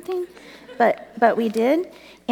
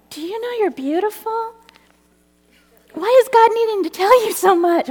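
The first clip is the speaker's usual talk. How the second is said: much higher, rising to loud; excited question; 10 semitones higher, 4 dB louder than usual. Retold or imitated. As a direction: urge it with wonder. Telling mom at the store.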